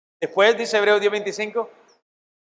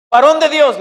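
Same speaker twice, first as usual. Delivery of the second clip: proclaimed; 6 semitones higher, 6 dB louder than usual. Acted. Preacher proclaims Paul's title.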